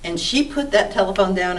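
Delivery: normal talking voice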